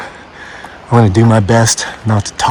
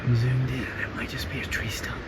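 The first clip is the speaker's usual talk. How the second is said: hushed; 17 dB quieter than usual. Real, not acted. Hushed while spotting something.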